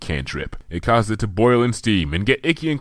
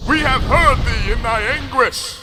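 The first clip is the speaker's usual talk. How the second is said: higher, louder royal proclamation; regal roar; 14 semitones higher, 5 dB louder than usual. A narrator's reading. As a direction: boom it down from the heavens. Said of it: "roared a voice from above".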